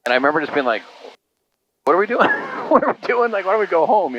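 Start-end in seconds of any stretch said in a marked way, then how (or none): 1.8-4.2 put-on high, bewildered little-kid voice; young kid bewildered. Boy asking dad at motel, high and bewildered. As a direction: mimic his confused younger self. Child voice retold, confused.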